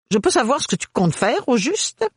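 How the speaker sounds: high, indignant mother voice; a scolding, disbelieving mother; astonished mother. A narrator's reading. Mother confronts Ophélie, high and astonished. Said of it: "s'étonna sa mère".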